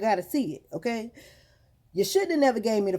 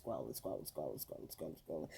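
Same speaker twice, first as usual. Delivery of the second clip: absorbed muttering, softer and softer; trailing-off repetition; 18 dB quieter, faster than usual. Retold or imitated. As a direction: fade right down to a low murmur. Mimics endless scrolling under her breath.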